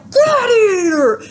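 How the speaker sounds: high, loud, drawn-out warrior yell; battle cry. Retold or imitated. Seminar guru drowning out the negative voice, battle-cry yell. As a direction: scream it like charging into the arena. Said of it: "that voice comes and he's like".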